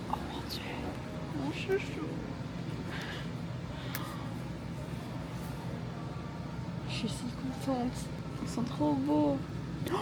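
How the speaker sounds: murmure émerveillé, très doux; chuchotement ravi. Real, not acted. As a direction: chuchote ta joie en découvrant les livres. Ravie dans une librairie. (chuchotement émerveillé)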